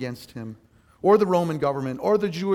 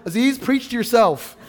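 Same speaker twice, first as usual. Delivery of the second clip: loud, high, laughing reproach; amused self-scolding; 5 semitones higher, 7 dB louder than usual. Retold or imitated. Preacher scolds himself over his own teaching, laughing. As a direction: tell yourself off through a laugh.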